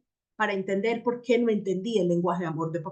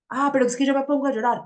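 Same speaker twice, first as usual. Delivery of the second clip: higher, louder, whiny excuse; mock whiny objection; 4 semitones higher, 5 dB louder, faster than usual. Listener objecting that she starts crying, mock whiny. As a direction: play the listener protesting she cries. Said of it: "Permítanse llorar".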